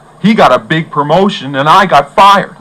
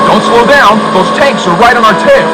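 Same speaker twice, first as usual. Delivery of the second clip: urgent; 5 semitones higher, 4 dB louder than usual. Acted. Chased by tanks.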